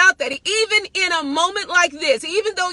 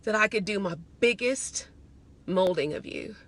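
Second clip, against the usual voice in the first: lower, softer, slowed voice of God; 6 semitones lower, 6 dB quieter than usual. Retold or imitated. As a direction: speak as God, low and hushed, to a beloved daughter. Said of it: "He says".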